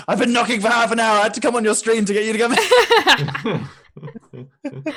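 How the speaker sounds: high, loud laughing complaint; mock exasperated shouting. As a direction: holler a hammed-up complaint through the door. Mock complaint at being locked out.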